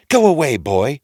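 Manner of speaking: high, loud stern woman; tall woman. A narrator's reading. Ogre's wife chasing Jack off, high and loud. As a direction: shoo him off sharply. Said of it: "said the big tall woman".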